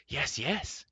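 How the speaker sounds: high, eager yes yes; eager cry. A narrator's reading. Gurgi eagerly crying out before battle. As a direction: bubble over with eagerness. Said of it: "he cried".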